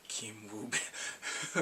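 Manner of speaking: slow, low, soft savouring; hushed swoon. Retold or imitated. Fan savouring a favourite actor's name, slow hushed swoon. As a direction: draw the name out low and softly.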